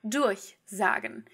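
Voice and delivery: slow durchsagen, DURCH stressed and set apart; deliberate first-syllable stress in durchsagen. Shown as a demonstration. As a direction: stretch "durch", then let "sagen" drop in durchsagen. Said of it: "because it's durchsagen".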